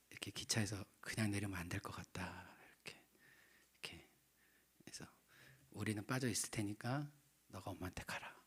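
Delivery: soft whispered instruction; hushed urging. Retold or imitated. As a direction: quietly send him to his mother. Sending son to mother quietly.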